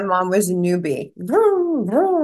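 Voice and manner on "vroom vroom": high, swooping "vroom" imitation; zooming noise. Guest shows her newbie mom zooming about, high swooping vroom. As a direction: mimic something whizzing past, pitched way up.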